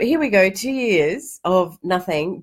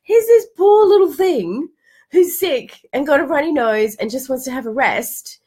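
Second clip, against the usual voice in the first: ordinary talk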